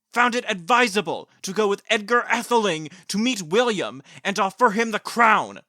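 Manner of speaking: loud, high, grand history-lesson voice; dramatic recitation. Acted. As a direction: declaim it like a pompous lecturer. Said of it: "I was just quoting, the passage".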